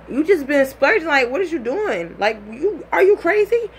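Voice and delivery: incredulous, building to a shriek; outraged disbelief. Retold or imitated. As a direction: girlfriend realising he spent her money on liquor, outraged. Her outraged reaction at liquor.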